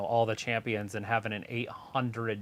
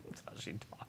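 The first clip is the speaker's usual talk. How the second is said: whispering; 5 semitones lower, 18 dB quieter than usual. Real, not acted. Remark on a wrestler's voice.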